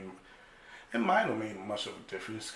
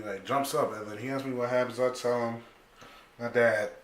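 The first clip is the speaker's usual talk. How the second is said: normal talking voice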